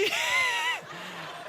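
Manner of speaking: high shrieking burst of laughter. Real, not acted. Host cracks up at Tony.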